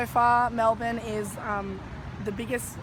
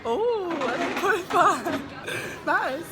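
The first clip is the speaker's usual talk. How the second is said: higher and louder, laughing at "Ooh"; laughing delight; 7 semitones higher, 4 dB louder, much slower than usual. Real, not acted. Agreeing with Chrissy's rating, higher and louder with delight. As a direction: squeal with amused approval.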